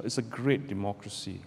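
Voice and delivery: ordinary talk, nothing marked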